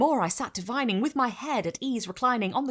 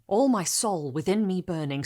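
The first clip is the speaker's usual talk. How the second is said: ordinary delivery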